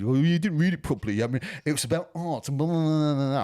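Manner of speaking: mock the hipster who told you off; lambasting hipster. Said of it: "some fucking hipster dickhead fucking absolutely lambasted me".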